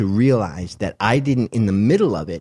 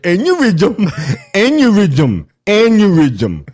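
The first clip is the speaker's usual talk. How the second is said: put-on drunk actor; 11 semitones higher, 10 dB louder, slower than usual. Mocking the drunk actor to the host, loud and high. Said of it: "That fucking actor was drunk".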